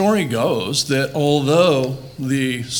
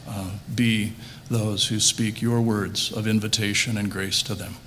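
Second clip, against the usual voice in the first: closing prayer; 4 semitones lower, 4 dB quieter than usual. Acted. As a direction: pray gently, easing into quiet.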